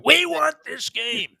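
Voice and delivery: high, slow, hammed-up coach yell; Dan Campbell. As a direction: bellow it like a fired-up coach. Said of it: "Dan Campbell, can you imagine?".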